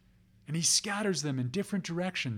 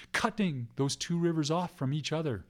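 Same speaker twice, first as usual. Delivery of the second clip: ordinary delivery, nothing marked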